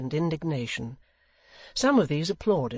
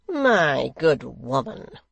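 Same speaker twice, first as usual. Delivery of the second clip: slow, loud, drawn-out reproach; condescending secretary; 7 dB louder, much slower than usual. A narrator's reading. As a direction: stretch each word with oily disapproval. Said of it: "said Gashford".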